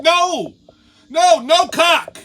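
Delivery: loud, high exasperated shout; screaming. Retold or imitated. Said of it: "Now I'm screaming".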